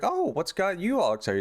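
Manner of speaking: high-pitched, surprised wife voice. Retold or imitated. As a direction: ask it with mock surprise. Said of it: "Anita's all surprised by this".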